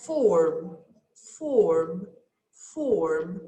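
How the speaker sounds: "Form" said three times low and deliberate; teacher dictating a listening item; dictation word. Shown as a demonstration. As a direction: read the test word slowly. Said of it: "Let's see number two".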